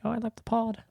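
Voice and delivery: light Australian impression of a celebrity; Australian accent. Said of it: "Was that your Australian accent?".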